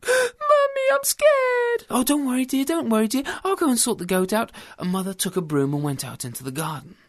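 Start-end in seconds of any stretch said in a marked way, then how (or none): 0.0-1.8 put-on very high, loud panicked child; shrieking, breakneck little-girl panic; frightened little girl. Frightened girl tells her mother. 1.9-4.5 high, fussing motherly voice; comforting mum, pitched up; reassuring mother. Acted.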